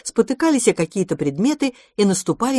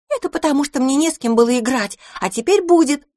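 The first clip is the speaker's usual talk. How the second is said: put-on high-pitched eager little girl; gleeful rising retort; excited; 8 semitones higher, 3 dB louder than usual. A narrator's reading. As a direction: argue back, bubbling with joy. Pipina excitedly answers her brother.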